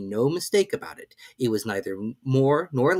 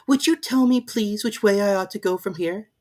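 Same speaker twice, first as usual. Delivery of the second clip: put-on high, lightly girlish Alice; young girl scolding a baby; Alice's voice; 10 semitones higher, 4 dB louder than usual. A narrator's reading. Alice voiced in read story.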